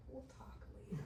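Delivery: soft, whispered we'll talk later; quiet warning. Retold or imitated. Mentor to the storyteller after hearing the story, whispered warning. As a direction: hush it like a gentle warning. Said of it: "Patty looks at me and she goes".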